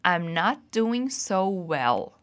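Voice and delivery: very slow, carefully spaced model sentence; fala lenta de modelo. Shown as a demonstration. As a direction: stretch every word for the learners to copy. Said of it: "So, repeat after me". (frase modelo bem devagar, palavra por palavra)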